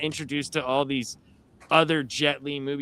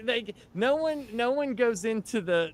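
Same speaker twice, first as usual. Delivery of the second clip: usual speaking voice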